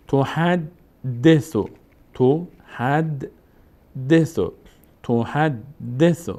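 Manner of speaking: "تحدث" very slowly with a double d; syllable-by-syllable. Shown as a demonstration. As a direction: stretch "تحدث", pausing on the doubled d. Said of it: "دال که تشدید داره باید دو بار خوانده بشه".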